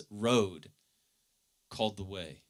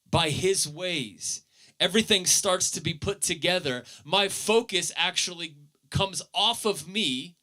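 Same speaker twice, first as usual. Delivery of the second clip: raised, driving sermon voice; fervent preaching; 8 semitones higher than usual. Acted. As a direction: preach it out with rising fire. Preacher building fervent energy.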